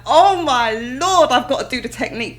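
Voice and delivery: petrified. Retold or imitated. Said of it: "'cause I was petrified".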